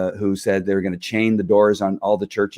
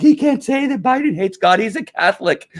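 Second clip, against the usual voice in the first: mocking; 12 semitones higher than usual.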